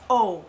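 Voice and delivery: O said as a rounded "oh"; a clear, held "oh" for O; long O sound. Shown as a demonstration. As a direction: demonstrate the long vowel in O. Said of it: "that has the long sound".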